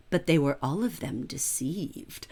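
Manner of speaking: hushed whispered ominous line; whispered elven foreboding; Galadriel. Retold or imitated. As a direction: breathe it like an ancient elf queen. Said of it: "when Galadriel says".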